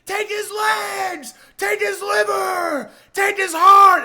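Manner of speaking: high, drawn-out bloodthirsty shout; cheering Colosseum crowd. Acted. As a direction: roar it like a mob in a Roman arena. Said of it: "they're cheering. Roman Coliseum style".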